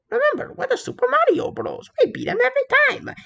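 high, fast, cocky pep talk; Mario boasting to Luigi, fast and proud; boastful Mario. Acted. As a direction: brag it out like the hero. Mario boasting to Bowser fans.

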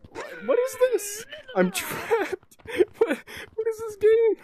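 high squeaky laughing disbelief; trapped and giggling, pitch shooting up; laughing panic. Real, not acted. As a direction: laugh through the panic, voice jumping way up. Panicked laughing at glitched game.

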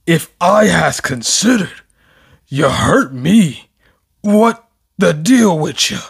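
lightly voiced dim giant; a hint of a lumbering brute; Gigantomachia voice. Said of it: "He sounded like Gigantomachia".

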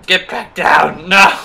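loud, slow command; urgent loud order; yelling. Real, not acted. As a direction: shout them back down. Yelling at enemy in fight.

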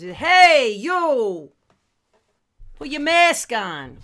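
loud tough Brooklyn "Hey, yo". Retold or imitated. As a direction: bark it like a Brooklyn street guy. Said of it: "respond the same way as".

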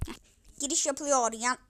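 ordinary delivery

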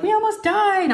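high, dramatic imitation of overexcited girls; hammed-up high-pitched drama; mock dramatic kids. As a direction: exaggerate it like kids retelling a scare. Girls' dramatic report retold.

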